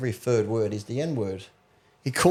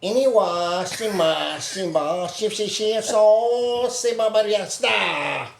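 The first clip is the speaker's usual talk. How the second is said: higher-pitched mock-Chinese nonsense syllables; 7 semitones higher, much slower than usual. Joker showing how Chinese sounds to them, mock Chinese. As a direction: chant out made-up Chinese sounds. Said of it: "when you listen to Chinese people".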